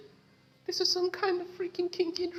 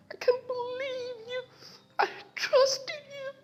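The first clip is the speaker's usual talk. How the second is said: hurt crying; 11 semitones higher than usual. Acted. Betrayed character crying out.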